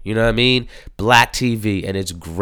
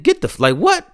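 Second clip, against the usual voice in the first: ordinary talk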